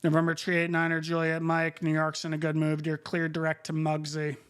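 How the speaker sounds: crisp controller radio call; air traffic control radio. Acted. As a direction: read clearances like a busy controller. Controller clearance.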